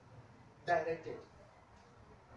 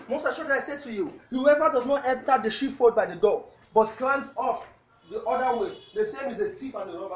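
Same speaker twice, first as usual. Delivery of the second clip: loud, fast sermon reading of the verse; preaching scripture reading; 8 dB louder than usual. Real, not acted. Pastor reading the verse to the congregation, preaching loud. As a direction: boom out the scripture to the church. Reads scripture at full force.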